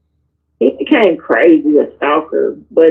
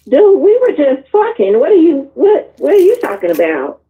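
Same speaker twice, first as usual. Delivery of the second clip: retold exasperation; 8 semitones higher than usual. Retold exasperated retort to him.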